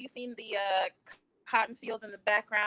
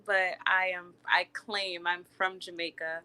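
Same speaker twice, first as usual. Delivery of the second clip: ordinary talk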